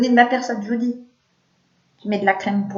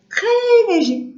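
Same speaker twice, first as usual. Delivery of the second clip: drawn-out high; 13 semitones higher, 6 dB louder, much slower than usual. Real, not acted. Playful drawn-out emphasis.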